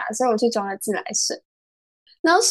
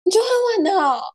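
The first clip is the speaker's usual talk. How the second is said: retold shock; 9 semitones higher, faster than usual. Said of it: "然后我才".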